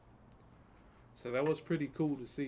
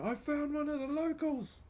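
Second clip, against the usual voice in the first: much higher, a hammed-up excited cry; thrilled shout, pitched way up; mock excited discovery; 13 semitones higher than usual. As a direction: play the thrilled wildlife host spotting a kangaroo. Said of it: "it just shows him running".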